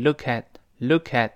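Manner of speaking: "look at" joined as loo-kat; linked sounds. Shown as a demonstration. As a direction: link the k of "look at" onto the vowel. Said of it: "连读变成".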